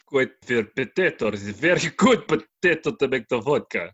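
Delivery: a light mock Russian accent; put-on Russian accent. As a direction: praise the potatoes like a Russian farmer. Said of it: "Can you guess that accent?".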